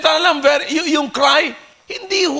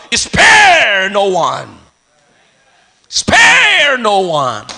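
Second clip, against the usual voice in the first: drawn-out, falling call; preacher's cry; 4 semitones lower, 4 dB louder, much slower than usual. Real, not acted. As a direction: stretch the first word out forcefully, then drop. Preacher crying out scripture.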